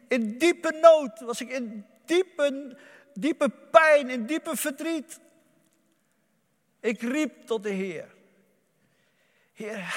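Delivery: loud, high impassioned preaching; preaching at full force. Acted. Preacher testifies to the congregation, loud and impassioned. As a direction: testify with rising fervor.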